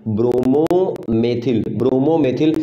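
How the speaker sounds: ब्रोमोमेथिल stretched out very slowly; slow teaching drawl. Shown as a demonstration. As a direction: lay out ब्रोमोमेथिल syllable by syllable. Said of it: "क्या बेटा, ब्रोमोमेथिल". (ब्रोमोमेथिल को बहुत धीरे खींचकर बोलिए)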